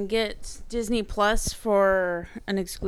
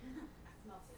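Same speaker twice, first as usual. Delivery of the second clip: laughing whisper echo; 26 dB quieter than usual. Real, not acted. Echoing friend's odd phrasing.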